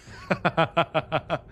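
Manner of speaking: bright burst of real laughter. Real, not acted. Streamer reacting to chat, laughing. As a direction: laugh in a quick run of ha-has.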